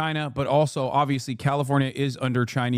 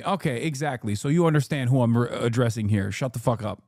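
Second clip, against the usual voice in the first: ordinary talk, nothing marked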